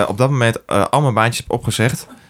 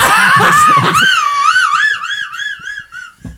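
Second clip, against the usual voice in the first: shrieking laugh. Real, not acted. Loud laugh. (gierend lachen)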